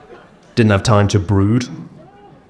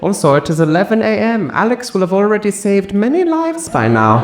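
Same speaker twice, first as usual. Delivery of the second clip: high, snooty judging voice; 10 semitones higher than usual. Retold or imitated. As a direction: look down your nose at him. Judgmental voice continues.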